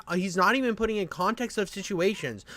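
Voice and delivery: usual speaking voice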